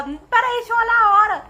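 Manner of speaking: loud, high mock interruption; mock calling out. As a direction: call out in hammed-up urgency. Mocks herself checking time while dying. (chamando alto e agudo, de brincadeira)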